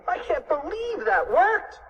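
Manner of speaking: high, slow, drawn-out amazement; amazed cry. A narrator's reading. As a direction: cry it high, stretching in disbelief. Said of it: "cried Cheeseball".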